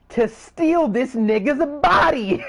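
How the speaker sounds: high, loud, slow villain gloat; gloating villain Xehanort. Acted. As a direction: savour the evil plan. Xehanort reveals his villain plan.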